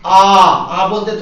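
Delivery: आ held long and slow; slow demonstration of a long आ; long vowel demo. Shown as a demonstration. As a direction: draw out the vowel आ to show its length. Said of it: "थोड़ा देर तक बोलते हैं आ".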